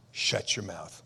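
low, soft command; quiet rebuke. Acted. Jesus rebuking wind and sea, low quiet command. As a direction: order it down with hushed authority. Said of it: "He rebuked the wind".

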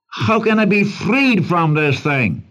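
high, slow, pleading question; cry for deliverance. Acted. Preacher voices a trapped man seeking a deliverer, pleading. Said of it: "It's a cry for a deliverer".

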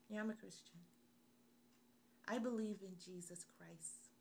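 very soft, reluctant admission; timid confession. Acted. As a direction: own up to it almost under your breath. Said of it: "Why don't we have that confidence?".

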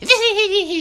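high, loud mock giggle; shrill put-on "hee-hee-hee" giggle; mimicked giggling. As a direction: imitate silly schoolgirl tittering. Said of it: "they're giggling with their fucking friend".

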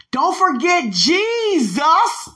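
high, loud and drawn-out cry; yelled reminder. Retold or imitated. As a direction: stretch Jesus out in a ringing yell. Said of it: "it took everything in me not to yell".

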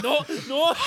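high, loud panicked cry; mock panic. Startled rider jumping out of the elevator, panicked yell. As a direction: yelp it as if fleeing.

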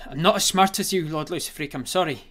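high, loud frightened grovelling; fearful grovelling murmur. Acted. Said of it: "And you grovel".

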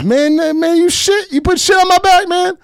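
very high, loud squealing outrage; high-pitched mock yell of disgust; outraged movie guy. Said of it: "And he goes".

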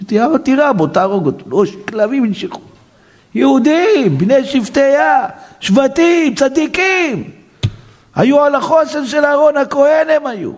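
high, impassioned exclaiming. Real, not acted. Rabbi exclaiming about righteous brothers, high impassioned. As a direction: cry out in amazement.

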